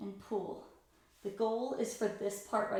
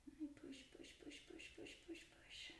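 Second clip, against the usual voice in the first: breathy effortful whisper while pushing; strained whisper; 6 semitones higher, 18 dB quieter than usual. Real, not acted. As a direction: whisper it out with each push of effort. Pushing shoulders down mid-stretch.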